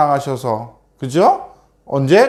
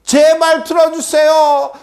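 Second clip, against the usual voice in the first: very high, insistent acted plea; pleading prayer; 12 semitones higher than usual. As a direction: beg the gods on one high, stretched note. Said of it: "하면서 계속해서". (높은 소리로 애타게 부르짖듯)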